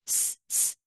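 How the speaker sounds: স- as a bare voiceless hiss. Shown as a demonstration. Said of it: "জিভটা নিচের দাঁতের সাথে লাগিয়ে".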